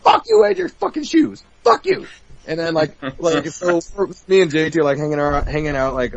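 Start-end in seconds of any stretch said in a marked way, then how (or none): 0.0-2.1 mock furious cursing. Wrestler venting at Bret Hart, high hammed-up fury. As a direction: curse him out in exaggerated outrage. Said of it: "I'm like".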